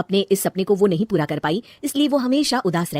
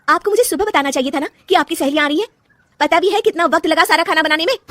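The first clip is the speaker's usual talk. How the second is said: higher, louder, fast resentful retort; daughter-in-law scolding her mother-in-law for the surprise guests; angry complaint; 8 semitones higher, 5 dB louder than usual. Acted. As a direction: let the pent-up frustration burst out at her.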